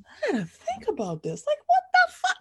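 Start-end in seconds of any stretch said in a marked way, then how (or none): 1.4-2.4 very high disbelief; high shocked outburst. Real, not acted. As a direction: squeal it in shock. Shocked reaction while reading.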